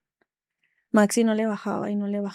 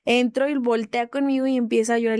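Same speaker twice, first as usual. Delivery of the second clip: ordinary talk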